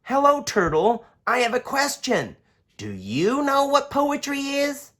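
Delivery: put-on bright curious kid asking; young boy Daniel. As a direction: ask like a curious little boy. Daniel child voice.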